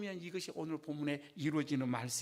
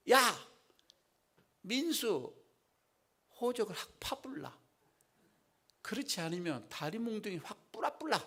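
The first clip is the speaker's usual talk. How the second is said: a mother's mock bellow, pitched higher; mock scolding; 5 semitones higher than usual. Busan mother threatening her son who won't come out, mock bellow. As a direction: play a Busan mother yelling at her son through the door. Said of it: "그 소리가 호통치죠".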